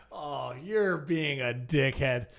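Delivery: normal talking voice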